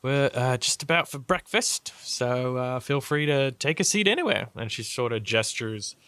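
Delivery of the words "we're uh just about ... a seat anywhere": high, lilting ladylike voice; airy, raised-pitch hostess; gracious lady. Acted. Lady inviting the travellers to sit for breakfast, high lilting voice.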